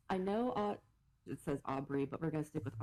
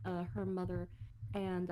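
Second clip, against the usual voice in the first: ordinary talk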